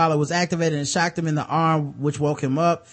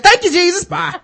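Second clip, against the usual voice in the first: much higher, louder prank-call voice; high mock praise; 13 semitones higher, 8 dB louder than usual. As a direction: squeak it out like a giggling kid. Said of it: "I'm like".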